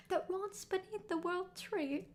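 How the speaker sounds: slow, high put-on Victorian British boy accent; hammy British boy accent, drawn out; bad Victorian British boy accent. Streamer reading the dialogue in a bad Victorian British boy accent. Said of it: "the really bad Victorian British boy accent".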